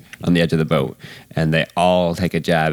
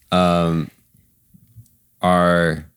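ordinary talk

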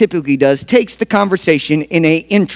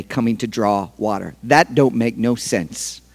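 soft, low and gentle; Jesus gently naming her past, soft and low; tender Jesus; 5 semitones lower, 4 dB quieter than usual. Acted. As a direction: speak it softly, with a tear in the eye. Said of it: "with the tear at his eye saying this".

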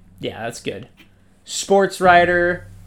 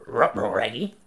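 Scooby voice; 5 semitones lower, 6 dB quieter than usual. Retold or imitated. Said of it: "I can't do a Scooby".

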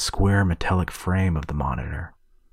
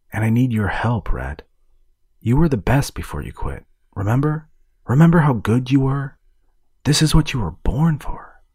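soothing; 7 semitones higher, 4 dB louder than usual. A narrator's reading. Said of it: "Fulcrum's voice was soothing".

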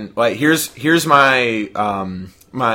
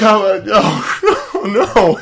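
laughing dismay; 9 semitones higher, 5 dB louder, slower than usual. Retold or imitated. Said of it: "I'm like".

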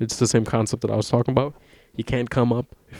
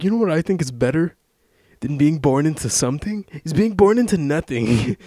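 mock guru, raised pitch with questioning rises; Gary V impression; 5 semitones higher than usual. Podcaster imitating the mogul's take on being born poor, mock guru voice. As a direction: pitch it up like a motivational mogul delivering wisdom. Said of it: "he'll be talking about".

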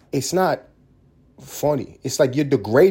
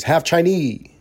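mock Chinese accent, louder and higher; 6 semitones higher, 4 dB louder, slower than usual. Comedian repeating his own phrase, mock Chinese accent. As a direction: exclaim it in a put-on Chinese accent.

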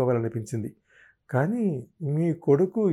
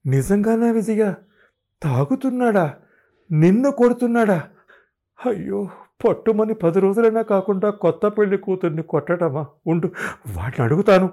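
much higher, louder, shocked outrage; mother-in-law alarmed and outraged for the bride; agitated alarm; 8 semitones higher, 6 dB louder than usual. Acted. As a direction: cry out in alarm then vow action. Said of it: "ఆవిడ కలవరపడుతూ".

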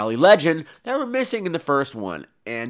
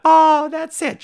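high put-on young woman's voice; high girlish voice; 13 semitones higher, 3 dB louder, much slower than usual. Danielle Harris's character asks Tony Todd, high girlish. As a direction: ask it wide-eyed in a high voice. Girl interrupts the story.

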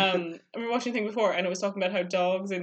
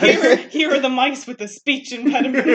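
laughing, loud announcer voice; 4 semitones higher, 10 dB louder than usual. Retold or imitated. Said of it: "they went".

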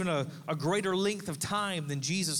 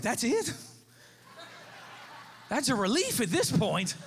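relieved surprise; 6 semitones higher than usual. Retold or imitated. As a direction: burst out in relieved disbelief. Relieved reaction to pregnancy news.

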